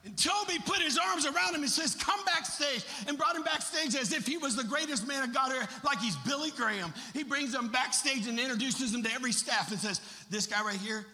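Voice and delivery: ringing, high-energy preacher storytelling; full-force preaching. Acted. Preacher telling how Pastor Toby welcomed his son, full-force preaching. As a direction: tell it big to a whole auditorium.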